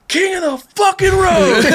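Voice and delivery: high drawn-out fan yell; imitated shouting. Drive-thru worker spots him from the dumpster, shouting. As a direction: holler it out like an excited stranger. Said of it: "he's, like, shouting it out loud".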